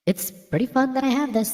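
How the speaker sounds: normal talking voice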